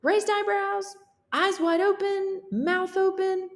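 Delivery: a character line reread in a squeaky voice; high character voice. Shown as a demonstration. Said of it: "read the dialogue one more time".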